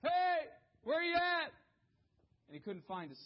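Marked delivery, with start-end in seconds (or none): 0.0-1.5 high, loud frantic calls for a lost son; frantic calling. Retold or imitated. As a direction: yell across the yard, panicking. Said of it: "started saying".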